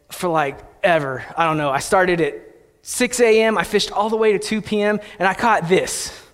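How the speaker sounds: normal talking voice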